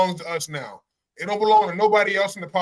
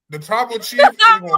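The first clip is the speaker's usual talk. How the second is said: hyped shout; 13 semitones higher, 10 dB louder than usual. Real, not acted. Hyping his own wrestler.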